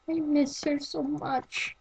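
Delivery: soft, tearful, breaking little voice; a sad robot fighting back sobs; tearful Space Core. Acted. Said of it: "recognized as crying".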